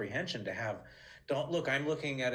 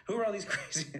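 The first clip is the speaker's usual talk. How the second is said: much higher, breaking into a laugh mid-line; a laughing, higher bewildered old-lady voice; laughing Estelle impression; 10 semitones higher, faster than usual. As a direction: try the impression again and crack up. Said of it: "but then she'd say".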